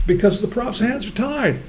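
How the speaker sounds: ordinary delivery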